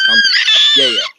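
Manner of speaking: "country" yelled very high and loud; hype shout. Acted. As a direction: bellow it like a hype man. Hyped announcer shout.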